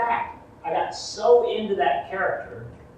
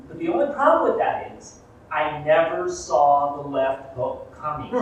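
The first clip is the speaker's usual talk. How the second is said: ordinary talk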